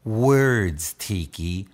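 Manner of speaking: low, hushed motherly voice; wise mother. Retold or imitated. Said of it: "my mother looked at me and said".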